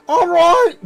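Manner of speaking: high, loud, squeaky-pitched goblin; high loud goblin butler announcing guests. Acted. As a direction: play an eager little goblin herald, way up high. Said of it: "he's like".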